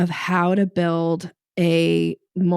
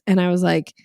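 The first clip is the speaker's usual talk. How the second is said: ordinary talk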